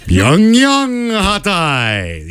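slow, high, drawn-out announcer voice; hammed-up state TV anchor, stretched out; north korean newsreader. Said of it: "of our favorite North Korean newscaster".

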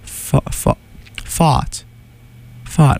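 lightly attempted British accent on a single word. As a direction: try out a British "fought", r dropped. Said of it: "like, a British person saying".

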